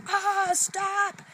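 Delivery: high, loud frustrated yell; retold yell. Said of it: "I yell".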